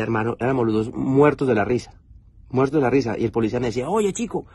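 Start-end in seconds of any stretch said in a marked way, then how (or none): 3.9-4.5 put-on much higher, playful Cuban cop accent. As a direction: imitate the Cuban officer's Cuban accent. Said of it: "porque él es cubano". (acento cubano del policía, agudo y divertido)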